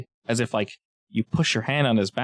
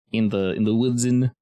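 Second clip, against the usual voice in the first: ordinary delivery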